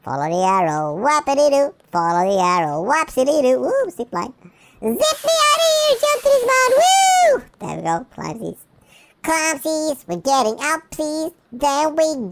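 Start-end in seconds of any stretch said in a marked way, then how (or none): 4.8-7.4 high squeaky thrilled voice; excited cheer. Acted. Puppy whooping on the zip line, high and excited. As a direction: ride it like a thrilled pup.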